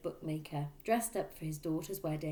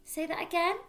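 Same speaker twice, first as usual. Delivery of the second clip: put-on higher, louder boy asking to repeat; a boy checking his notes; son; 12 semitones higher, 6 dB louder than usual. A narrator's reading. Son asking his father to repeat the price, higher.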